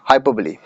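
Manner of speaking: हाइपरबोली on its own, ending "blee"; real pronunciation. Shown as a demonstration. Teacher repeating for students, हाइपरबोली ending in "lee". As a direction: model हाइपरबोली as hai-per-blee. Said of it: "इसका real pronunciation होता है".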